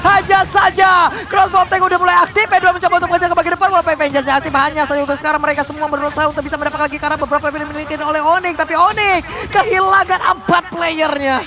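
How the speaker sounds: loud, high hype play-by-play; fighting game announcer. Real, not acted. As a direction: yell the teamfight like an arena announcer. Hype teamfight casting.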